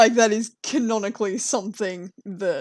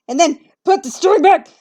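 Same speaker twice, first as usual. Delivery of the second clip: rising, louder burst of glee; excited; 7 semitones higher, 10 dB louder than usual. Real, not acted. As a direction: cheer it out as it finally fits. Triumphant drawing moment.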